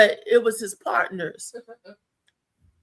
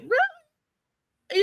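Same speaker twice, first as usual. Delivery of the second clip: surprised. Retold or imitated. Quoted surprise, far.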